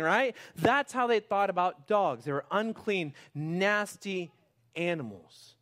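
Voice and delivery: loud, rising high preacher's build; full-force sermon climax; impassioned preaching. Real, not acted. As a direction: pour it out to the church.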